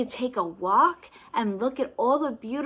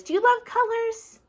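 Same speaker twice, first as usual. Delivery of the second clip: put-on high, bright-eyed question to a child; eager question; 12 semitones higher than usual. Storyteller asks the child eagerly about colors. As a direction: ask it up high with delight.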